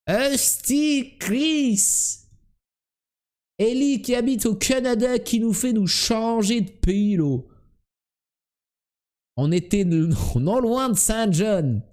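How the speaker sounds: high, exasperated Québécois swearing; mock-outraged Québécois, pitched well up; Québécois accent. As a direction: complain in a hammed-up Québécois accent. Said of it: "quand tu imites les Québécois".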